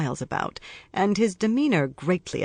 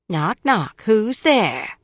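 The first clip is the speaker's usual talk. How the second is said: louder, taunting sing-song; searing mock; 6 semitones higher, 5 dB louder, much slower than usual. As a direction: jeer back at the voice in the dark. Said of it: "calls back in a searing, mocking tone".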